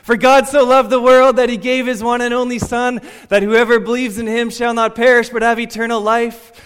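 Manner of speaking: shouted at full voice, high and loud; yelled verse. Retold or imitated. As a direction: belt it out across the square. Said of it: "Fine, I'll do it again".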